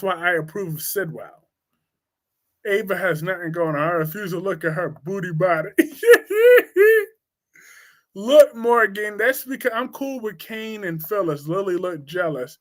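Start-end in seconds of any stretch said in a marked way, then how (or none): 5.7-7.0 shrieking laugh. Real, not acted.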